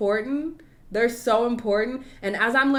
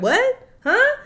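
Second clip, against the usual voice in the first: high, louder puzzled questions; mock confusion; 13 semitones higher, 6 dB louder than usual. Imitating herself on a call with a rep, mock confused. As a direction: play dumb at a heavy accent. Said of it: "So you're like".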